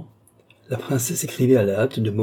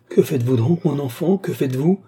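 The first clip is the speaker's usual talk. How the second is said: higher, dismayed exclamation; crying out; 6 semitones higher, 3 dB louder than usual. A narrator's reading. Princess crying out to the young woman she sheltered, dismayed. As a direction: cry out in protest at the sight. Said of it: "s'écria madame Louise".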